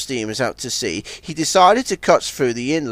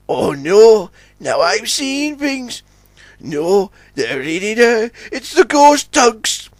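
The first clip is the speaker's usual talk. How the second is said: high voice of mounting alarm; frightened O J; 8 semitones higher, slower than usual. A narrator's reading. O J sees ghost tugs behind him, frightened. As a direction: build from dismay to a horrified cry.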